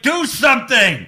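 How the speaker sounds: loud, high exasperated yell; retold yelling. Fans at the TV with minutes left, retold yell. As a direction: re-enact yelling at the screen. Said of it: "me and Andrew are yelling at the T V".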